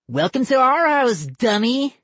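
put-on high teasing boy; mock the dummy gleefully; kid voice. Boy character voice.

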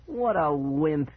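slow, drawn-out taunt; teasing. Acted. Brother mocks his fooled sibling, teasing slowly. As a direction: tease with stretched-out words. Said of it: "he teased".

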